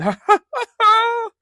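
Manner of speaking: high, loud joyous laugh; splendid laugh. Acted. Scrooge rediscovering laughter, high joyous laugh. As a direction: laugh out in pure delight. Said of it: "it was a splendid laugh".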